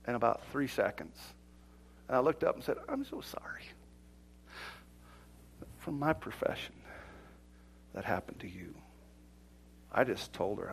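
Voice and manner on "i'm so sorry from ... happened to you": very soft, shrunken apology; sheepish apology. Retold or imitated. Pastor apologizing to a wronged woman, sheepish apology. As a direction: say it small and hushed, as if cut down to size. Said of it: "I went from six-foot-four to about two-foot-two".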